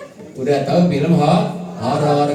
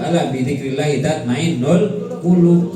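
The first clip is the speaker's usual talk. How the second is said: Quranic recitation; 3 semitones higher, 4 dB louder than usual. Acted.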